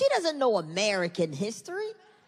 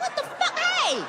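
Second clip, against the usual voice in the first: much higher, startled cry. Retold or imitated. Startled husband, much higher yelp. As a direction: yelp like you got scared. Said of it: "I'm like".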